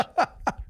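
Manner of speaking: high breathy laugh; burst of laughter. Real, not acted. Laughing at own joke.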